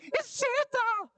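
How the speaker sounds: very high, slow squeal of joy; excited like Elf. Retold or imitated. Preacher imitating Elf spotting Santa, high excited squeal. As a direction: squeal like a kid at Christmas. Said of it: "I do get excited about stuff like that".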